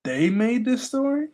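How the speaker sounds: surprised by the twist; surprised question. Real, not acted. Streamer reacting to the legend, surprised rising question.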